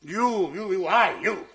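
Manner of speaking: loud broken stammer; sputtering rage. Acted. Husband sputters at wife, enraged. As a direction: choke on fury.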